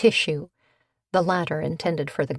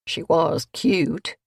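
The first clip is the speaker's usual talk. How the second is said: muttered; slower than usual. A narrator's reading. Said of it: "Maybelle muttered".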